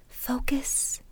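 normal talking voice

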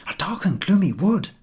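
eager high shout. A narrator's reading. Eager third boy shouting his answer to the professor. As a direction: burst it out with relief. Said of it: "shouted the third boy".

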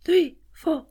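high gasped count; inflating with each number; counted in-breath. Shown as a demonstration. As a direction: count upward on quick inhales. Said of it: "This time, four and eight".

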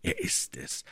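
very soft, almost voiceless murmur; murmured to himself. A narrator's reading. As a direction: let the realisation slip out under your breath. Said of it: "murmelte Trevet". (kaum hörbar gemurmelt)

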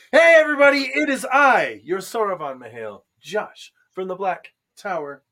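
loud, high showman hello; grand host intro. Pretend. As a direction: announce yourself with fanfare.

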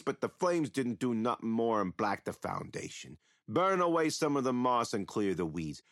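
ordinary delivery

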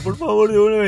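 put-on high whiny little boy begging; pleading kid. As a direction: beg like a crying child. Niño llorando pide sus tazos. (niño suplicando con voz aguda)

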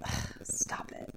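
whispered, fed-up ugh; whispered groan. Retold or imitated. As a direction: breathe out a tired stop it. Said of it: "I was like".

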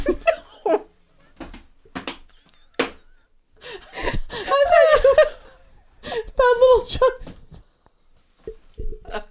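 high squeaky helpless laughter; can barely get words out laughing; laughing hysterically. Real, not acted. Helpless laughter over licorice joke.

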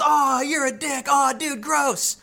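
high, drawn-out hammed-up groan of disgust; mock disgusted classmates. Classmates reacting to the prankster's fart, mock disgusted whine. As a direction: mimic teens recoiling from a bad smell. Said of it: "And then everyone goes".

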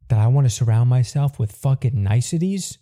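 ordinary talk, nothing marked

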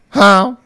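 loud, high honk closing in. Retold or imitated. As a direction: honk harder, the goose is nearer. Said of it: "It's getting louder".

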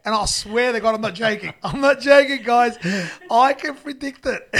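loud and high, breaking into laughter; high, loud comic protest; loud, amused insistence. Real, not acted. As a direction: swear it's true over the laughing. Telling staff sick-day joke, laughing.

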